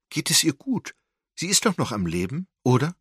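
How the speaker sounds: high, loud, worried pleading; fearing the worst, pressing for an answer; anxious. A narrator's reading. Wendy anxiously asks the nurse if grandmother lives.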